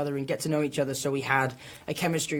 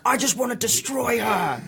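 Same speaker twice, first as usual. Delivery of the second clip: mock aggressive, slow and menacing; slow, hammed-up menace toward a rival; half-joking aggressive threat; 7 semitones higher than usual. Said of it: "really aggressive".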